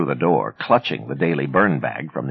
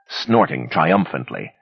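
usual speaking voice

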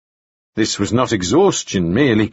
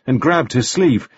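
ordinary delivery, nothing marked

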